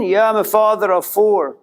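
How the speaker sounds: lightly voiced proud man; voicing Russell. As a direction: state it like an identity. Russell answers about children.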